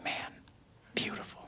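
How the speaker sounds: very soft, near-whispered wonder; hushed awe. Retold or imitated. Groom gazing at the ocean before first look, hushed awe. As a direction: murmur it to yourself, awestruck.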